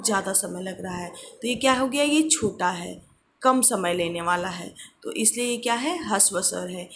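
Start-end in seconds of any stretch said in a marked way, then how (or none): none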